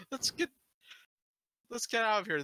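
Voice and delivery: high, urgent plea to flee. Acted. Urging escape from attack.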